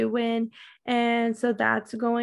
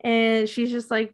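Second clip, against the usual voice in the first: usual speaking voice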